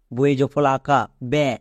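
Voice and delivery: ordinary delivery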